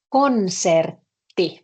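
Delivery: konsertti said slowly, double t held; slow Finnish word. Shown as a demonstration. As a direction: lay out konsertti slowly for the class. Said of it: "here is the word".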